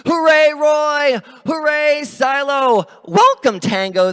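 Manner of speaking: loud high cheer of hooray. Acted. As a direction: cheer like a happy crowd. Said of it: "they cheered".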